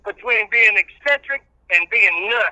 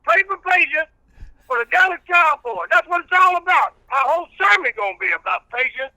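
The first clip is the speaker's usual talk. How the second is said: country preacher character; 8 semitones higher than usual. Acted. Old reverend preaching patience to the radio host, in full preacher voice.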